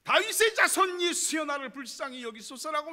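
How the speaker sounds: loud high shout to Jesus; preacher shouting a beggar's plea; shouted cry. Shown as a demonstration. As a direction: cry out with all your strength. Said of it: "더욱더 악을 쓰고 소리 질러야 됩니다".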